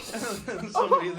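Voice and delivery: ordinary delivery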